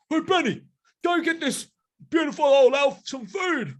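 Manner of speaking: very high gorilla giving orders; changed gorilla voice. Acted. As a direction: send your mate off in a high put-on voice. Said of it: "I keep changing the voice".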